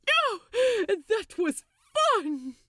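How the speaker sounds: high, loud, slow gleeful cackle. A narrator's reading. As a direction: crow with delight at the stunt. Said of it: "Crowley cackled".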